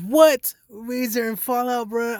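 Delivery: high, loud what followed by stunned names; shocked disbelief. Real, not acted. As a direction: blurt it out in shock. Hearing about the opening bands.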